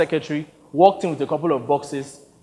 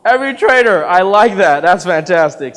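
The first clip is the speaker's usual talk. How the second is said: high, loud amused praise; delighted; 3 semitones higher, 10 dB louder than usual. Acted. Director enjoying Victor's quip, delighted and loud. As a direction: savor the line with glee.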